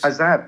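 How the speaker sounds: Azam said a-ZAM with flat a vowels; mispronunciation. Retold or imitated. Repeating the commentators' error, mispronounced Azam. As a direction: stress the second syllable of Azam, as in jam. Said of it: "And it's not Azam".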